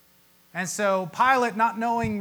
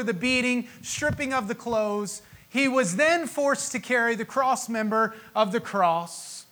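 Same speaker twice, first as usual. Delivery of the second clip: normal talking voice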